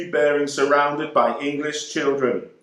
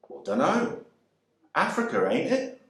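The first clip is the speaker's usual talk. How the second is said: offhand English soldier; 2 semitones higher, 4 dB quieter than usual. A narrator's reading. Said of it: "And hear him reply".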